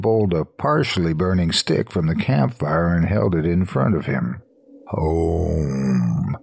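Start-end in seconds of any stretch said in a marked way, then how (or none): 4.9-6.4 drawn-out monster call; guttural creature. A narrator's reading. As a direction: draw the word out like a lurking creature. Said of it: "it repeated".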